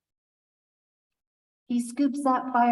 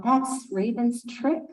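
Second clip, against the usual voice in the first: plain reading voice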